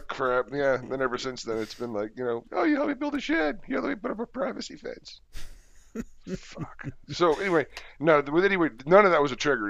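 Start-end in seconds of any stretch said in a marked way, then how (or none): 2.5-5.2 much higher sing-song mockery; squeaky teasing impression; mocking high voice. Mocking neighbor's lines. 6.4-6.9 breathy whispered swear; whispered curse. Real, not acted. Muttered curse.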